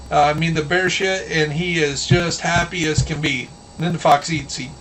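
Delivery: ordinary talk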